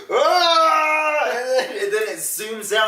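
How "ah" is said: loud, high-pitched mock scream; imitated scream. Retelling the walrus reveal to a friend, loud imitated scream. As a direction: shriek it out in imitation. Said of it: "like that".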